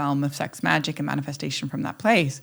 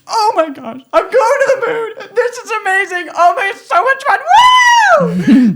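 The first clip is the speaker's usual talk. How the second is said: high, loud squealing delight at the moon trip; high excited squeal of a child at play; childlike glee; 7 dB louder, slower than usual. Pretend. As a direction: play a kid thrilled in a cardboard rocket. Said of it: "I'm sitting in a cardboard box and I'm going".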